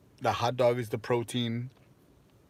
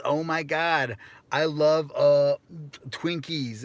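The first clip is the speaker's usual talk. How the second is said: mock gushing; 4 semitones higher, 4 dB louder, slower than usual. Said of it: "is that an American accent".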